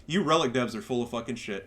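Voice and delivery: normal talking voice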